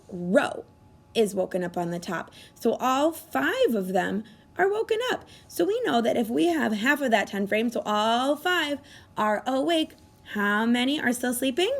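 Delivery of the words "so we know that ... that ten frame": usual speaking voice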